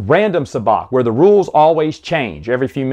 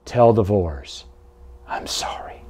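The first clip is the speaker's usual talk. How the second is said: slow, low, fading almost to a whisper; hushed, sorrowful imitation; sad farewell; 5 semitones lower, 7 dB quieter, slower than usual. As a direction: voice a retold sad last apology, softly trailing away. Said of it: "he turned to her kind of sadly".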